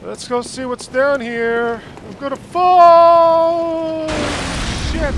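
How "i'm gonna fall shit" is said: long drawn-out panicked yell, high; screaming while falling. Real, not acted. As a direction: shriek it as you drop. Player's character drops off ledge.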